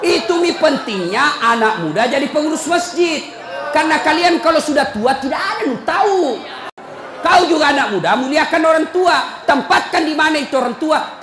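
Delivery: loud, high scolding sermon voice; fiery preaching. Acted. Preacher scolding the mosque committee, loud and fiery. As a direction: preach it hard at the committee, laughing between. Preacher urging young people.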